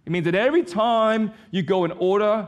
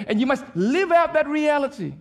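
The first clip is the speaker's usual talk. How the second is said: ordinary talk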